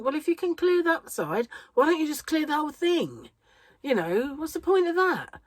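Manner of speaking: high, exasperated rhetorical question; lightly mock-indignant. Exasperated thought about her nose.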